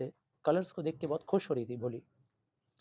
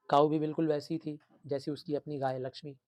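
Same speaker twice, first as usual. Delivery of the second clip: ordinary delivery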